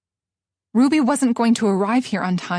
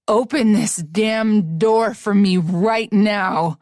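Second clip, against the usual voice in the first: slow, heavy, louder; furious demand; 3 dB louder, much slower than usual. Acted. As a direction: drag it out like a threat. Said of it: "he demanded".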